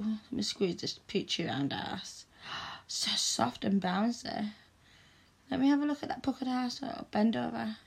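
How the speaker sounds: ordinary talk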